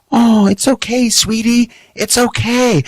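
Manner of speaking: high, cooing reassurance; babying the dog. Acted. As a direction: soothe the dog like a baby in a high sing-song. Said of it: "we baby the dog".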